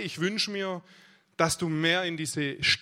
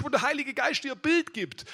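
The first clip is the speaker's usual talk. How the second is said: ordinary talk, nothing marked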